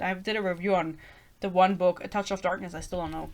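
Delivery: usual speaking voice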